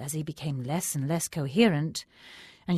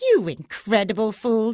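higher, louder contemptuous screech; screeching hag; 6 semitones higher, 8 dB louder than usual. A narrator's reading. Hag scorns the cowering Faki, screeching. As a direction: spit it at the foolish sage. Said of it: "screeched the hag".

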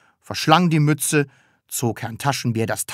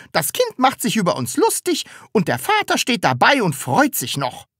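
indignant onlooker; 10 semitones higher than usual. A narrator's reading. Onlooker complaining about child and father.

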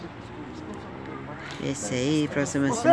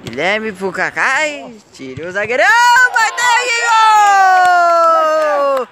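explosive goal commentary; 11 semitones higher, 9 dB louder than usual. Real, not acted. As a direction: hold the goal call as long as you can. Narrates own goal play-by-play. (narração de gol explosiva com grito longo)

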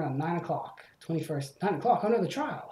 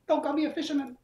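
much higher, offended character voice; indignant yell; 11 semitones higher than usual. Acted. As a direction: fire it back, insulted. Fisherman character objects indignantly.

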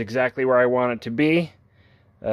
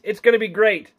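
excited invitation; 9 semitones higher than usual. Acted. Upbeat convention invite outro.

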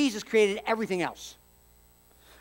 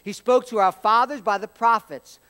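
louder, proclaiming read; scripture reading; 2 semitones higher, 5 dB louder than usual. Acted. Preacher reading Hebrews to congregation, louder and proclaiming. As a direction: proclaim the verse with weight.

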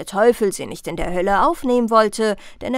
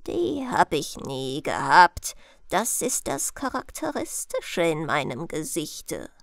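put-on mysterious old one; 4 semitones lower, 3 dB quieter than usual. Disguised Death describing his face to the princess, mysterious. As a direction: explain it deep down, dropping softer.

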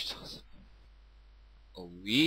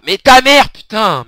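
much higher and louder, stress on ta; exasperated yell; 6 semitones higher, 11 dB louder than usual. Real, not acted. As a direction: burst out in frustration at the game. Rage au jeu vidéo. (cri exaspéré)

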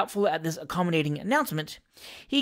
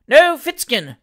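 high, loud jeer of a mob; roaring crowd; 6 semitones higher, 9 dB louder, slower than usual. A narrator's reading. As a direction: roar against the rival candidate. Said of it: "roared the crowd".

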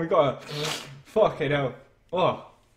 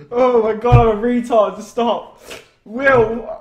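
loud, high, overwhelmed outburst; real emotional overload, voice raised; overcome with emotion; 4 semitones higher, 9 dB louder, slower than usual. Real, not acted. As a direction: cry out as emotion gets the better of you. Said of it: "I'm getting a bit emotional".

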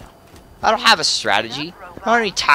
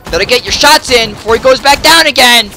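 high, loud, mounting frustration; exasperated rant; 7 semitones higher, 9 dB louder than usual. Real, not acted. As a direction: raise it to an exasperated yell. Ranting at boss dodging.

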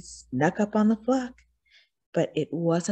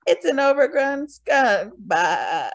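higher, mocking sheep bleat; 6 semitones higher, 3 dB louder than usual. A narrator's reading. Sheep mocking the disguised wolf, higher and bleating. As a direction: jeer like a sheep. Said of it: "laughed the sheep".

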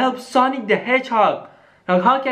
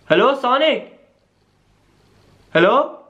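normal talking voice

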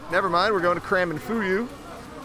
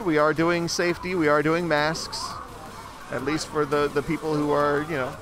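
normal talking voice

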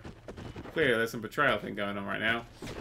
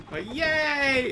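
high, sarcastic squeal of joy; mock cheer; 12 semitones higher, 5 dB louder than usual. Player mocking visiting enemies, mock cheer. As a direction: fake a chirpy cheer.

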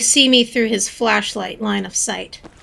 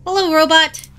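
ordinary delivery

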